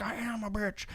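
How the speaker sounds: very soft, hushed reply; quiet mock admission. Thanos owns up to Peter's insult, quiet mock admission. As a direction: drop right down for a comic quiet confession. Thanos answers, meekly, the taunt.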